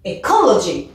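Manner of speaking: "Ecology" with COL punched, then falling; light E, strong COL in "Ecology"; stress on second syllable. Shown as a demonstration. Teacher models four-syllable word, second syllable stressed. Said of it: "on doit accentuer sur la deuxième".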